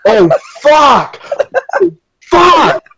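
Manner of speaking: high-pitched exclamations of shock; suddenly remember and cry out, spaced apart; floored, voice shooting up; real stunned outburst. Real, not acted. Floored on being reminded of Jenny.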